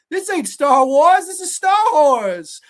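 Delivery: high, loud, drawn-out protest; mock outburst. Fan protesting that this ain't Star Wars, loud and high. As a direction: belt it out slowly in mock outrage.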